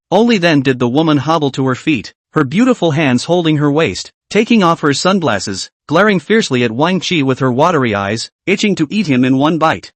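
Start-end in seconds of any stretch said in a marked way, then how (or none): none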